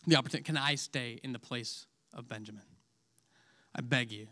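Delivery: soft, low, begging plea; pleading. Retold or imitated. Judah begs Joseph to take his place, softly pleading. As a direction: plead for Benjamin, voice sinking low. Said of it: "it's a very emotional scene".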